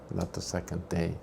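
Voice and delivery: ordinary delivery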